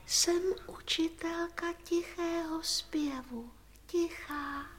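soft whispery introduction; tichý hlas. Acted. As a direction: introduce yourself in a secretive hush. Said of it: "Neumí nahlas, je tichá". (šeptavě, potichoučku)

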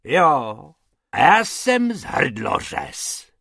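loud, drawn-out menacing boast; irate, blustering threat; angry roar. A narrator's reading. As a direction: roar it slowly at the rabbits. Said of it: "zařval pan Hrubec".

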